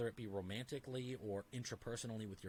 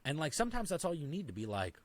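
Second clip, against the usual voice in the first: ordinary delivery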